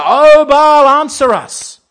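high, very loud desperate plea; a pagan prophet's shouted plea; frantic cry to Baal. Pretend. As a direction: cry out to a god who won't answer. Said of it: "Shout out louder".

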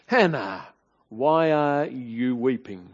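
slow, reproachful husband's question; impatient husband mildly accusing his weeping wife. Shown as a demonstration. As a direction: let reproach drag through the question. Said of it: "can you hear the, the tone?".

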